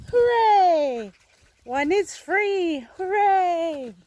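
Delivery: high, loud hooray; cheering. Real, not acted. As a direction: cheer as the bird flies free. Bird freed, cheering.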